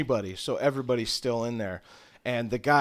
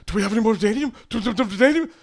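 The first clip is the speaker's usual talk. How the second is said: high, loud, frantic babble; acted panic, stuttering; panicked freak-out; 8 semitones higher, 7 dB louder, much faster than usual. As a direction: stammer frantically at your assistant. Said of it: "He's just in a panic, freaking out".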